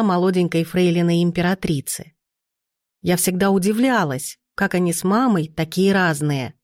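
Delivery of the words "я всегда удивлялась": usual speaking voice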